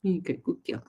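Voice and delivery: ordinary talk, nothing marked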